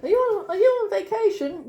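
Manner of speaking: high, perky imitation of a curious local; acted-out chirpy small talk; eager retold question. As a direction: pitch it up like an inquisitive stranger.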